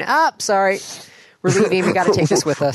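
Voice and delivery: a sheepish clerk taking the chapter back; apologetic bookstore clerk. Retold or imitated.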